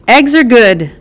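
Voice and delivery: high, loud answer called across the house; yelled back. Acted. Son calling back to his mother, loud yell. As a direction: call it back to the kitchen. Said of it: "Darius yelled back".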